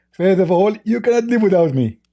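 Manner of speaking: high, mocking put-on voice; mock commenter voice. Reading out a commenter's boast to mock him, in a high put-on voice. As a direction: parrot the commenter in a silly high voice.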